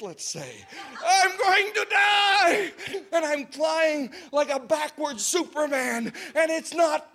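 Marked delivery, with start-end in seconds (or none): 1.0-2.7 yelling in panic. Retold or imitated. Teen flung off the coaster, yelling slow and high in terror. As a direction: scream it like you're falling. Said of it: "I was a little panicked".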